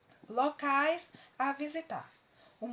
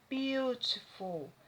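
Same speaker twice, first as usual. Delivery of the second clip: British English accent, beautiful with a crisp clear T; British pronunciation; much slower than usual. Shown as a demonstration. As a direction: say beautiful the British way, sharp T in the middle. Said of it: "O britânico, ele pronuncia".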